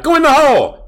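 high, loud golf-crowd holler; will the ball in with a yell; spectator's yell. Retold or imitated. Guy in the golf crowd yelling at the ball, loud holler. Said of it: "you always hear a guy yell out".

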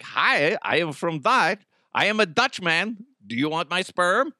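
imitation Dutchman making an offer; mock Dutch accent. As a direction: put on a bad Dutch accent. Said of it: "I don't know what Dutch people sound like".

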